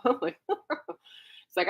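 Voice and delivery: laughing. Real, not acted. Laughing while gushing about guitar.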